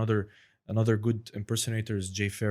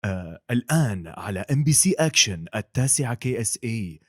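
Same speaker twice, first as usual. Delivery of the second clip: fast, louder Arabic trailer announcer; Arabic sports channel promo; 4 dB louder, faster than usual. Shown as a demonstration. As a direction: rattle it off like a TV promo voiceover. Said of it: "which is the Batman, The Dark Knight trailer".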